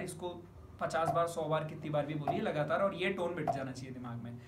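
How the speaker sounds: ordinary delivery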